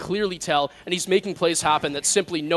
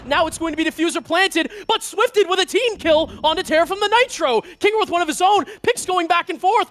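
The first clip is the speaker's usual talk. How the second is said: excited esports caster; 9 semitones higher, 3 dB louder than usual. Real, not acted. Live play-by-play, clutch moment.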